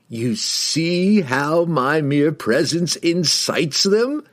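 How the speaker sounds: slow, high-pitched villain gloating; proud boast. A narrator's reading. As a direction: boast grandly, drawing it out and rising at the end. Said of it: "Kessel boasted proudly".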